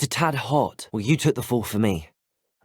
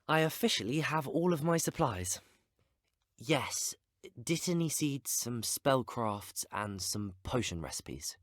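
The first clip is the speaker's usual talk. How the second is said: soft, hushed tally of items; quiet to oneself; 3 semitones higher, 10 dB quieter than usual. Acted. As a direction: go softly through the list, as if to yourself. Checking off supplies to oneself.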